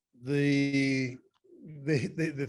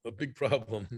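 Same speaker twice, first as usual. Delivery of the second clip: talking through hiccups; hiccuping between words; 3 semitones lower, 4 dB quieter than usual. Real, not acted. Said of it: "I can't believe I got the hiccups".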